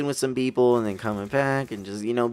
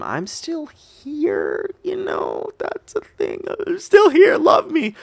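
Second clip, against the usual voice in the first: much higher, drawn-out whiny plea; needy mock plea; 5 dB louder, much slower than usual. Left standing behind the girls, a high whiny plea for attention. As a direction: beg for attention like a left-out kid. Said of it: "standing back like".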